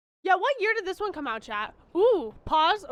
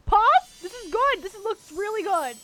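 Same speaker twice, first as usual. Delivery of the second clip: usual speaking voice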